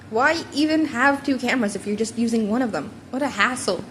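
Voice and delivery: ordinary delivery, nothing marked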